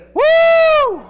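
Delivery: high, loud pulpit whoop; joyful whoop. Real, not acted. Preacher rejoicing at the cross, joyful whoop. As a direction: let out a piercing "woo".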